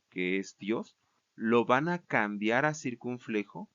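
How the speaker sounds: ordinary talk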